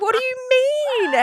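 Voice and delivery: drawn-out high confusion; mock alarmed self. Speaker on the phone to her husband, high puzzled question. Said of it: "I was like".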